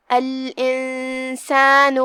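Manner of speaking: الانسان very slow, hidden nasal noon; ikhfa with ghunna. Shown as a demonstration. Teacher showing ghunna in الانسان, slow recitation. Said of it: "تو ہم غنہ کے ساتھ یہ حکم کو ادا کریں گے".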